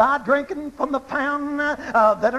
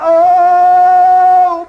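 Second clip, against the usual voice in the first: sudden loud held oh, pitched higher; preacher's cry; 4 semitones higher, 12 dB louder than usual. Real, not acted. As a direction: cry out the oh in rising fervour.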